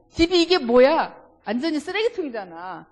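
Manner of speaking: 화난 듯이 크게; a bit angry. Shown as a demonstration. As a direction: snap it out like an annoyed husband. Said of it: "좀 화난 것처럼".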